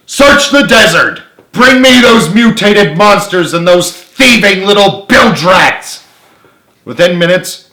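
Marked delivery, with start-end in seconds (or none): 0.1-6.0 high, loud, slow raging command; furious order. A narrator's reading. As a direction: roar the orders at the troops. General orders soldiers furiously.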